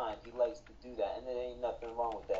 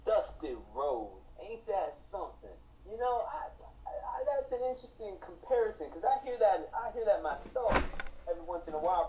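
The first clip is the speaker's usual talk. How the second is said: hint of Dusty Rhodes; 7 semitones higher than usual. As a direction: slip partway into a wrestler's promo. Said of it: "I sense a little bit of Dusty Rhodes in you".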